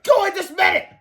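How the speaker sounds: high, loud scolding order; teacher shrieking a command; crying out. A narrator's reading. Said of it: "she cried".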